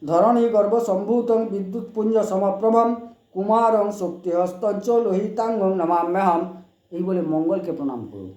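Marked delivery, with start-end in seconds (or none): 0.0-6.4 raised, chanted Sanskrit prayer; mantra recitation. Shown as a demonstration. Astrologer gives viewers the Mars mantra, chanting. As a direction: intone the Mangal mantra. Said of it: "মঙ্গলকে এই মন্ত্র বলে করুন".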